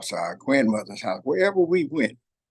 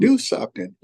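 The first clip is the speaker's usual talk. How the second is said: put-on higher, insistent little-girl demand; granddaughter's voice; 10 semitones higher, 3 dB louder than usual. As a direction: mimic a small girl pushing grandpa to play. Grandpa voicing pleading granddaughter.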